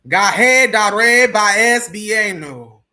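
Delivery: slow, forceful, authoritative incantation; with authority. Shown as a demonstration. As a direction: command the spirits to obey. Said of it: "say with a certain amount of authority".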